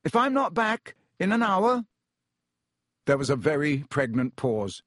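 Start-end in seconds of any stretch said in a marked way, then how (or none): 0.0-1.9 high, urgent, raised voice; tense, high-pitched calling. A narrator's reading. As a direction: call instructions across a barrier, leaving the last one hanging. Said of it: "he called to Ron".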